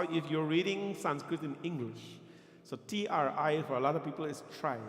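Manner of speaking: ordinary delivery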